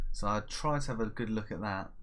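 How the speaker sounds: ordinary talk, nothing marked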